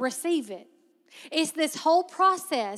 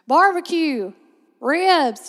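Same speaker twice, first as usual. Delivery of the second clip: louder, calling out the answers; eager answer; 6 dB louder, much slower than usual. Retold or imitated. Students calling out answers to the etiquette teacher, eager and loud. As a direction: shout the guesses like an eager student. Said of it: "people raise their hand".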